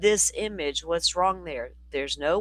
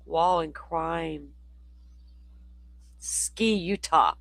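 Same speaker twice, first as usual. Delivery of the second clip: imitating Jay; much slower than usual. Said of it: "I can't do a Jay impression".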